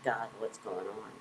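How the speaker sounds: low, soft plea to God; a quiet prayer whispered to Spirit; hushed prayer. Retold or imitated. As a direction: murmur the question to God.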